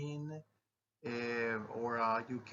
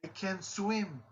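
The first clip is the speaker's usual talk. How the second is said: high, frightened "I can't swim"; hammed-up fear, as if about to be thrown into a pool; panicked exclamation; 7 semitones higher than usual. Shown as a demonstration. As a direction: plead in mock panic, pitched well up. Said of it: "I tried too hard".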